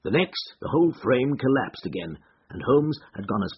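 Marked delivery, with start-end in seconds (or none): none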